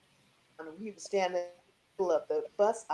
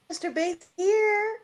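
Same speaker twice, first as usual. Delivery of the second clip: put-on high childlike question; a small girl asking hopefully; eager little girl; 12 semitones higher, 4 dB louder than usual. Young self asks hotel staff.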